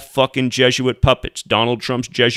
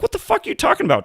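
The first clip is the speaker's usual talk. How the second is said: exasperated disbelief; faster than usual. Real, not acted. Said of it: "exasperated retort".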